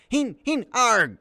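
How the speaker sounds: loud, high outburst of rage; frustrated shout. Acted. Boy misses the deer with his arrow, shouting in frustration. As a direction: yell out in angry disappointment. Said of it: "Pinch shouted in rage and disappointment".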